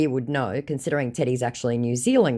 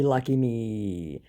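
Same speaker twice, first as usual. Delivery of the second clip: dry sarcasm; 2 semitones lower, 3 dB quieter, much slower than usual. Retold or imitated. Sarcastic reply to scammer.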